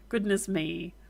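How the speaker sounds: ordinary talk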